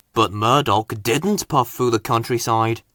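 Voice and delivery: usual speaking voice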